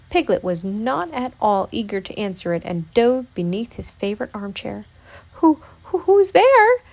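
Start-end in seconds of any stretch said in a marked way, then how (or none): 5.3-6.8 high, loud, stammering squeak; frightened squeak. A narrator's reading. Piglet under the armchair at a knock, squeaking in fear. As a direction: squeak it fearfully from a hiding place. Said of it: "he squeaked".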